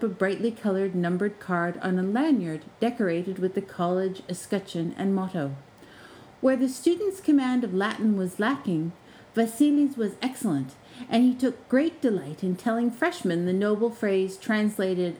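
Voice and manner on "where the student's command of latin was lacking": usual speaking voice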